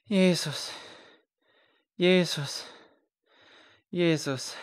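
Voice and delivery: anguished repeated moans, trailing off; groaning. Acted. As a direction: moan the name in anguish. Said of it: "Er stöhnte".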